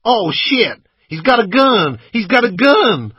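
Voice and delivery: very high, loud cry of alarm; panicked shout. A narrator's reading. Passenger sees gun at driver's cheek, panicked yell. Passengers see a pistol drawn.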